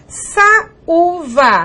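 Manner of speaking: "Saúva" drawn out as sa-ú-va; vowels separated. Shown as a demonstration. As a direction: slowly set the "ú" apart in "Saúva". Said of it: "separada da vogal anterior". ("Saúva" devagar, com o "ú" separado)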